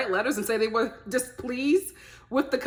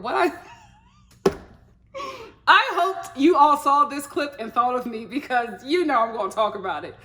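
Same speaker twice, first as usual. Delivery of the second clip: ordinary talk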